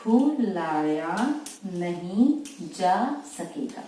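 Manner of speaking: drawn out word by word, slow; teacher dictating each word slowly for students; slow dictation. Shown as a demonstration. Shorthand teacher dictating भुलाया नहीं जा सकेगा slowly. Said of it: "इस तरह से लिखेंगे आप".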